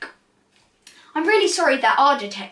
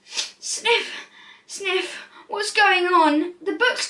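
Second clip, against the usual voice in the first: put-on sniffly, upset child's voice, high; a little boy close to tears; mild mock crying; tearful boy; 7 semitones higher, much slower than usual. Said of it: "he started to cry".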